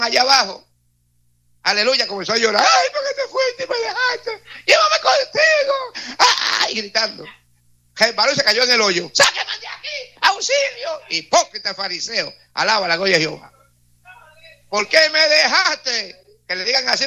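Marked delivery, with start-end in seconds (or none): 2.7-6.2 very high, slow mock wailing; hammed-up wailing widow. Preacher mocking a hypocrite widow at the grave, shrieking and wailing. 6.2-6.7 mock wailing shriek. 9.1-11.0 very high cry for help; mock panicked cry. The widow, fallen into the grave, screams very high for help. 14.7-16.4 mock wailing. Mocking widow again.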